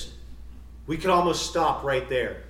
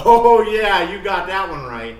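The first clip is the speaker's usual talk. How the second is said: loud mock heckle, settling toward the end; wife calling out; 5 semitones higher, 11 dB louder than usual. Preacher voicing his wife at the back, mock loud agreement. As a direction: imitate a spouse heartily agreeing from the back. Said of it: "my wife starts screaming back there".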